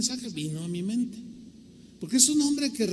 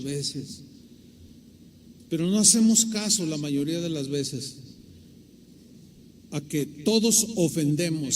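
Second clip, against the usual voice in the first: earnest, unhurried prayer voice; closing prayer; 4 semitones lower than usual. Acted. The preacher leads the standing church in prayer, reverent and earnest. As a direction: pray over the congregation with reverence.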